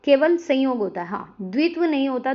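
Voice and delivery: ordinary talk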